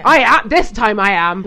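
loud, put-on stern mum; mock stern parent. Mum threatening the kids, loud mock sternness. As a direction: bark it like a fed-up mum. Mum playing out a toy standoff.

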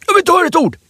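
high-pitched furious outburst at a table guest; retold furious yelling. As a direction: blast her with rage, no room to reply. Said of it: "Kicken bara säger".

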